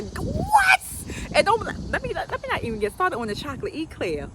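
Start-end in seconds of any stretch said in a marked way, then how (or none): 0.0-0.8 high-pitched "what?" of delight; mock amazement. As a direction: squeal it in exaggerated disbelief. Mock amazement at remembered cookies.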